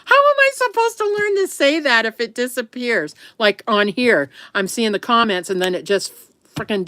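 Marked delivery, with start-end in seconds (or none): 0.1-3.2 higher, mock-exasperated complaint. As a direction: throw up your hands in comic frustration. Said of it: "I'm like".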